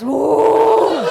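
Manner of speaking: high, loud drawn-out "ooh"; mock drugged whoop. Daughter claiming drugs to mom, mock woozy whoop. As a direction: whoop like someone pretending to be high.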